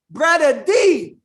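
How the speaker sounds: high, loud, drawn-out Southern call; Southern accent. Retold or imitated. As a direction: call out slowly as an imitated Southern sister. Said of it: "she had that Southern accent".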